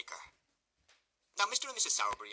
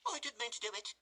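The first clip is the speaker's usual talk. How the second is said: higher, whimpering mock blubber; 13 semitones higher, 4 dB quieter than usual. A narrator's reading. Noah caught by the beadle, blubbering. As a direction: snivel like a caught schoolboy. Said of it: "said Noah, blubbering".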